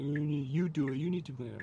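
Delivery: whiny sibling bickering, imitated; mock whining. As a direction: mimic a kid whining at a sister. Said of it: "he's whining to his sister".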